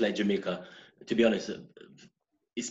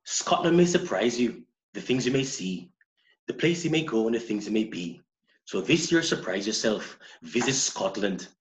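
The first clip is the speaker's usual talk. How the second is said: put-on Scottish accent; 2 semitones higher than usual. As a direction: read it like a Scottish tourism ad. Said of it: "my best Scottish accent".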